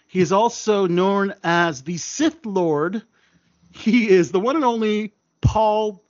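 hype introduction. Acted. Introducing guest dramatically.

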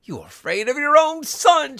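put-on high, loud, outraged old woman; frantic, accusing scream; struggling cry. A narrator's reading. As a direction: shriek it while struggling free. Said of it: "she cried, struggling".

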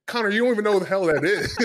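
high, quick mock scolding; fast, incredulous ribbing of a brother; exasperated. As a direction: retell it as an amused telling-off. Retold scolding of brother, laughing.

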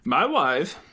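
mock Kazakh accent on the catchphrase; Borat. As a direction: deliver the catchphrase in a silly foreign accent. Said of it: "Can you say".

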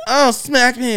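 high-pitched playful imitation of pleasure; mock eager cry. Man quoting his date in bed, mock eager cry. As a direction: mimic an eager lover, voice lifted.

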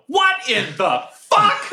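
high, slow, incredulous yell. Acted. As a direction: yell it in disbelief from the crowd. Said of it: "I just yell".

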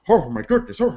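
lightly imitated manic comedian; Robin Williams. Pitching another voice pack, light Robin Williams impression. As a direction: exclaim it as a brief celebrity bit. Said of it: "What about Robin Williams?".

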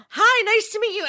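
high-pitched playful greeting; Angela. Retold or imitated. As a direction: greet a child as a delighted new friend. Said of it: "And I was like".

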